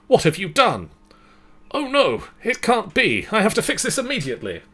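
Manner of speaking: fast frantic panic at the mistake; a character's voice shooting up in alarm; panicked Isaac. Acted.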